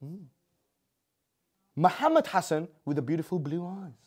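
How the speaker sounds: normal talking voice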